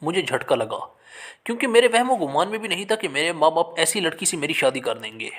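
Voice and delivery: plain reading voice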